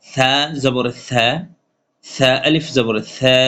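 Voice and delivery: slow, deliberate letter-by-letter drill; teacher's slow tajweed drill; slow spelling drill. Shown as a demonstration. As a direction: spell it out slowly for students. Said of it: "এক লিপ টেনে পড়তে হবে".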